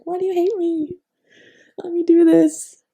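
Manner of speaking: put-on high, loud whiny teenage pleading; whiny teen plea. Teen begging her mom, high and whiny. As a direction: beg like a dramatic teenager.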